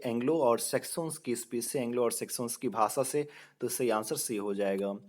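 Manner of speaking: normal talking voice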